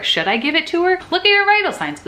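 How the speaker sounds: high-pitched frantic muttering to yourself; mock panic. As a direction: act out your own flustered rookie self, pitch climbing. Retold panic over patient meds.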